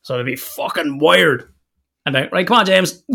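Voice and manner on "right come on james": put-on raised, brisk call to the child. Dad calling son James to leave creche, loud and brisk. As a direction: round him up, loud and cheerful.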